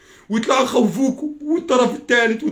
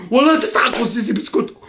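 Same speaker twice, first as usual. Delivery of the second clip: usual speaking voice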